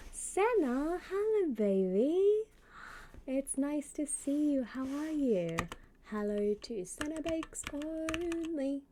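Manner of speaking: high, drawn-out cooing hello; effusive greeting. Real, not acted. Streamer welcomes Senna to the chat, effusive. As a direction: greet them in a lifted, stretched-out voice.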